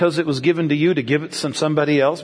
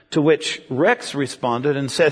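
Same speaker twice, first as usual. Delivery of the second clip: ordinary delivery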